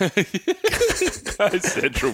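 drawn-out echo. Pretend. Friend repeats the catchphrase.

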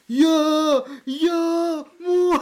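very high, loud goal shout; screaming goal. Retold or imitated. Shouting about the goal while throwing up on the ground, full scream. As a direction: scream the goal like a football fan. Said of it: "skriker jag mitt i allt".

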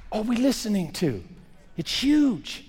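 ordinary delivery

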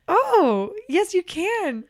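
high, loud, melting yes; touched reply. Retold or imitated. Nanny answering a little girl's sweet request, touched. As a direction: re-enact being won over by a sweet request.

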